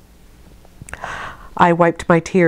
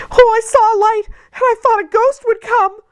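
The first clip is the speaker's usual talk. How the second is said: put-on frightened child. A narrator's reading. Frightened child explains the light.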